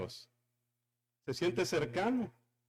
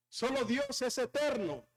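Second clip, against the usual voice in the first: normal talking voice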